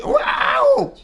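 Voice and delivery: very high shriek of a woman seeing a spider; mock spider scream, far up in pitch; long, piercing falsetto "waouh"; imitated frightened scream. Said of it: "Pourquoi une femme, elle, elle voit une araignée".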